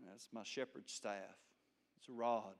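lightly acted, low and soft answer; subdued reply. Moses answering God about his staff, subdued reply. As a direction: drop right down, small and humble.

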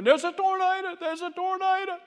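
high, frantic screaming warning; Mama bursting in screaming about the tornado. Retold or imitated. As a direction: shriek it like a panicked mother. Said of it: "Mama come in there screaming".

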